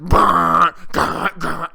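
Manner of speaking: loud buzzing car-bass noise; mouth-made bass blasts; imitated bass drop. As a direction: imitate a booming, rattling subwoofer with your mouth. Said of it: "and then it's".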